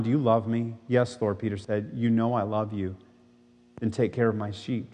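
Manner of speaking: low, measured Bible reading; solemn gospel reading to the congregation; scripture reading. A narrator's reading. Reads scripture passage aloud.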